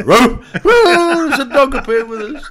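high, loud squawking parrot voice; talking macaw. Retold or imitated. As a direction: squawk it out like a cheeky bird. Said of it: "He'd go".

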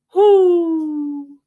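long, loud, high hoot; owl hoot. A narrator's reading. Owl fails to crow, loud high hoot. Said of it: "he just hooted like an owl".

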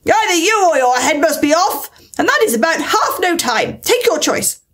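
high-pitched, imperious queen's outburst; acted royal fury, pitch up; shouted threat. A narrator's reading. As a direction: threaten them like a raging storybook queen. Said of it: "shouted the Queen".

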